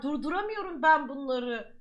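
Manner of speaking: ordinary talk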